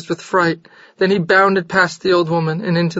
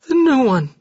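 higher, stunned gasp; 7 semitones higher than usual. A narrator's reading. Jorgis stunned by the news, gasping. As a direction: gasp it in shock. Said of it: "he gasped".

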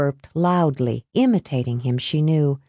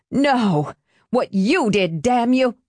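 roaring; 5 semitones higher, slower than usual. Acted. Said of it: "she roared".

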